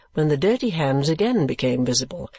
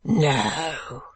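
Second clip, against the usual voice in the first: gruff, drawn-out refusal. Acted. Man below wall answering old gentleman, gruff. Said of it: "answered the gruff voice".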